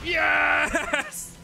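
high, drawn-out cheer; triumphant shout. Real, not acted. Celebrating in the game.

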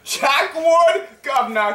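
high, insistent mock-British catchphrase; put-on British accent. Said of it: "his freaking British accent".

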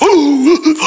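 high gasp for air; gasping ooh. Retold or imitated. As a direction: gasp like just pulled from the water. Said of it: "He's like".

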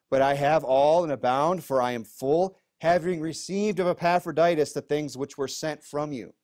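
scripture reading. Acted. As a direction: read the verse out with weight to the room. Reads Philippians aloud to congregation.

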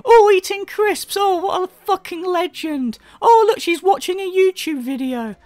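high-pitched mock excitement; sarcastic mock awe. Commentator mocking a streamer for eating crisps, sarcastic awe. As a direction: gush in a high, fake-impressed voice.

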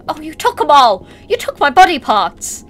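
indignant outburst. Real, not acted. Player scolds mannequins that stole her parts, mock outrage.

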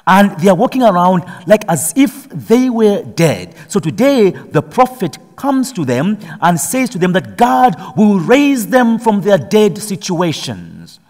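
impassioned sermon climb; preaching at full force. Acted. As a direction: drive the message home to the church.

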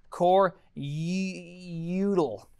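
"util" with a long, stretched "yoo" start; drawn-out pronunciation. Real, not acted. Coder typing core util, "util" drawn out as "yoo-dul". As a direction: stretch the first sound of "util" slowly, then finish "-dul". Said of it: "That's how I say it".

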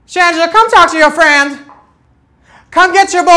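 high, loud call across the room. Pretend. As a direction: shout for her to come get him. Calling out to Shangela.

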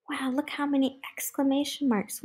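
ordinary delivery, nothing marked

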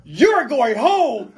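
loud, slow, drawn-out verdict with a laugh; slow it down and boom out the verdict; dramatic verdict. Retold or imitated. Judge telling a contestant, loud slow verdict. Retelling sending dancer home.

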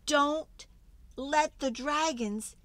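high, urgent pleading; urgent panic. Acted. Reader frantically warning the dragons off spicy tacos. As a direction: beg the dragons to stop before it's too late.

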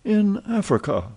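wide-eyed marvel at being in Africa; amazed repeat. Acted. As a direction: voice jumps high in wonder. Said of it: "repeated Passepartout".

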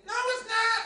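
high, loud squeaky bug voice; a sassy roach talking back. Acted. Roach answers from the TV.

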